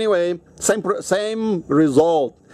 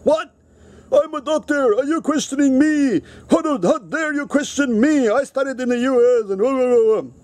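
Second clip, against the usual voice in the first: high, indignant outraged doctor; angry doctor; 8 semitones higher than usual. Retold or imitated. Doctor snaps at a patient who questions his prescription, high and indignant. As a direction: bristle with wounded pride at being questioned. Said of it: "And then he got really angry".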